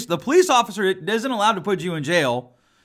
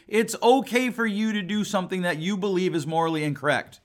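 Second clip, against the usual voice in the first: ordinary talk, nothing marked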